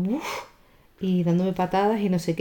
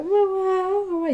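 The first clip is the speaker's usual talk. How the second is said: put-on crying child's call; 13 semitones higher, slower than usual. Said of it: "llorando y gritando".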